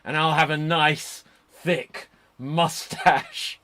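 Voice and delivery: Eli Cottonwood. Retold or imitated. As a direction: imitate a wrestler's boast, stretching the last words very slowly. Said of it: "He's like".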